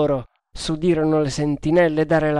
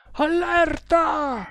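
high, drawn-out cry of alarm; shouted alarm; 14 semitones higher, much slower than usual. A narrator's reading. Sepoys firing and raising the alarm, shouting. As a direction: cry the warning out long and high. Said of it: "fecero fuoco gridando".

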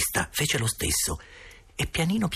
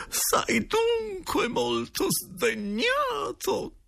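very high, drawn-out falsetto wail; hammed-up plaintive falsetto question; wheedling directress; much slower than usual. A narrator's reading. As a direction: plead slowly for the uncle's pardon. Directress coaxes the silent ghost.

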